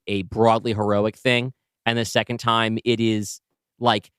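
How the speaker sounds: ordinary delivery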